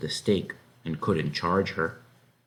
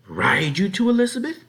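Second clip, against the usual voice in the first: high, loud disbelief; incredulous wife; 12 semitones higher, 7 dB louder than usual. A narrator's reading. Farm wife repeats the plan in disbelief. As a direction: throw it back in disbelief.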